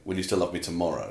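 a serious quiet moment, no lift at the end; quiet, no rise. Shown as a demonstration. Carol before bed asking softly, falling, not rising. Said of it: "doesn't involve going up at the end".

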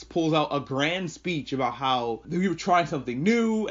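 ordinary talk, nothing marked

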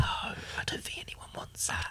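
hushed slow whisper. Pretend. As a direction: keep it under your breath. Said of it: "Why are you whispering it?".

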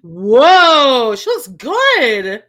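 loud, drawn-out amazed reaction; big slow wow at a reveal; real surprise. Real, not acted. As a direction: let it burst out, stretched and impressed.

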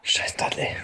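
hissed whisper. Real, not acted. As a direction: whisper it under breath. Quiet insult at character.

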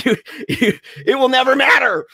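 high, halting, hammed-up wail; stammer, then wail it high; mock wailing protest. Storyteller protesting dad's "get over this", mock wail. Mimics himself bawling at dad's advice.

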